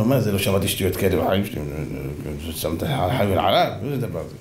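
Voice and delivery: low-pitched, puzzled king. Retold or imitated. The king reacting to the story. (מלך תמה בקול נמוך)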